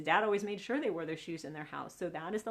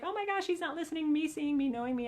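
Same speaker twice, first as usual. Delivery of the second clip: frustrated wife; 7 semitones higher, faster than usual. Acted. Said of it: "And Lisa's like".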